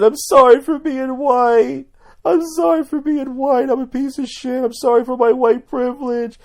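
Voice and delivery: loud, very high put-on voice; hammed-up high-pitched confession; mock wailing apology. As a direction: grovel in a high-pitched mocking cry. Troll's mock apology in shackles.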